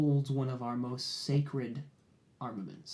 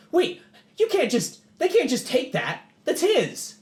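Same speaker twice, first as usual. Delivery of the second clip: much higher and louder frantic plea; panicked protest; 11 semitones higher, 9 dB louder than usual. Acted. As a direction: beg them desperately to give it back. Leland protests in distress.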